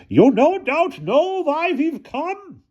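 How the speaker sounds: very high, slow shrill guard. A narrator's reading. Guard confronts Hannah Frankenstein at the castle, shrill voice. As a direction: pipe it out high and drawn out. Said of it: "said in a shrill voice".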